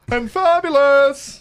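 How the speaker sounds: high, loud, slow campy drawl; mock fabulous. Host jokingly praises himself, slow high camp voice. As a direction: ham up being fabulous.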